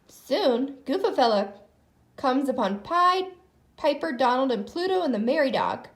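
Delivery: usual speaking voice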